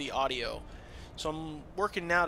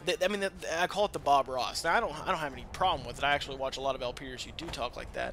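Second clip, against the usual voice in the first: ordinary delivery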